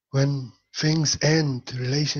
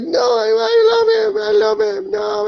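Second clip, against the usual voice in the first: very high, loud mock crying wail; little girl cries to Jesus to keep her teddy bear, very high and wailing; 7 dB louder than usual. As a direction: wail like a small child sobbing over her toy. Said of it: "ah, crying".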